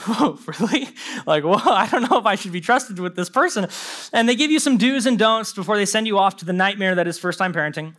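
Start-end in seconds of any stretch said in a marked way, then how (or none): 0.1-3.7 giggling through the words; laughing disbelief. Retold or imitated. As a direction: laugh at your own doubt. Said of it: "and you're like".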